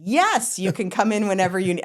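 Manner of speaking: high, fast eager reply; mock eager official; eager yes. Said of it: "they said".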